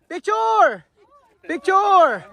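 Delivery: high call to gather the group. Real, not acted. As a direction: call them over for a photo.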